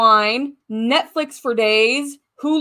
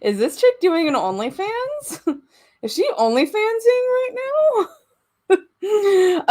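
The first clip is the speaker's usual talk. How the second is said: high, nosy neighbor voice, gossiping; mock nosy neighbor; 9 semitones higher, slower than usual. Neighbors imagining her streaming, mock gossipy high voice. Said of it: "my neighbors are probably like".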